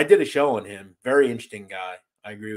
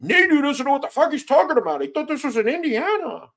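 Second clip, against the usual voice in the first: high, sneering mock commenter voice, pitched way up; mock hater; 14 semitones higher, 4 dB louder than usual. Streamer parroting a snide commenter, high mocking voice. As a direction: lampoon the smug critic in a squeaky put-on. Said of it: "And it's like".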